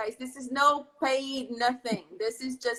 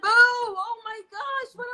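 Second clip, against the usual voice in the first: high, drawn-out delight; thrilled by a favorite place; excited gasp; 10 semitones higher, 5 dB louder, much slower than usual. Real, not acted.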